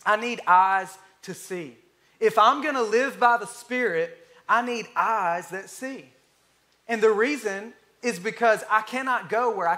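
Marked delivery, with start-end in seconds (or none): 0.0-1.8 each word spaced out for note-taking; slow dictation. Shown as a demonstration. Preacher to congregation, slow and deliberate. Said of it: "You can write it down". 4.5-6.1 even slower restatement, word by word; slow repeat. Shown as a demonstration. Preacher repeating the point, drawn out slowly. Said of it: "You can write it down".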